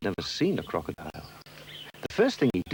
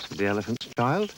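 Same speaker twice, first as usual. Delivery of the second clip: plain reading voice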